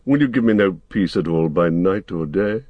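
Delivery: kindly, aggrieved voice asking; a lightly voiced old wizard feigning injured kindness; gentle question. A narrator's reading. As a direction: sound wronged but sweet, coaxing them. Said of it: "it said now with gentle question".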